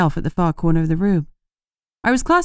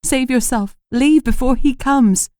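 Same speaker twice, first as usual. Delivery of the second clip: desperate plea; 6 semitones higher, 3 dB louder than usual. A narrator's reading. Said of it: "she whispered desperately".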